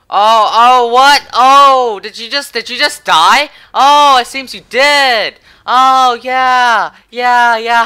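giddy triumphant squeals; gleeful gloating. Real, not acted. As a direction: crow over the enemy's death. Enemy just died, gloating.

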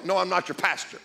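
normal talking voice

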